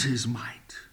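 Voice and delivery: whispered yes; a hushed reply to the congregation. Real, not acted. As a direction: breathe the answer almost silently. Hushed climax of point.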